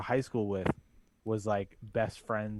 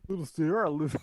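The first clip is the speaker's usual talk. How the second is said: E-40 impression; 4 semitones higher, 4 dB louder than usual. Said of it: "a fucking E forty impression".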